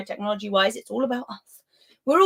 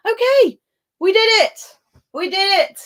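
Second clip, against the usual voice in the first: much higher and louder, a yelped "we did it"; real relief, cheering; 11 semitones higher, 8 dB louder than usual. Real, not acted. Streamer cheering to her chat after the last question, squealing with relief. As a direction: throw both arms up in your voice, it's over.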